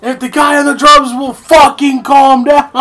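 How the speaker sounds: high, loud, slow yell, half laughing; mock furious officer. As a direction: holler at the drummer over the racket. Officer yelling over the drums.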